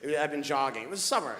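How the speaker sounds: usual speaking voice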